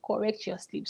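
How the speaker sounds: "Correct your sleep" repeated softly under the breath; quiet echo. Real, not acted. Vlogger repeating the quote, softly. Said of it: "are we expecting another one".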